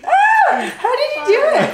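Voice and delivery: high, loud amazed cry; amazed shriek. Real, not acted. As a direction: shriek in disbelief. Amazed at the trick.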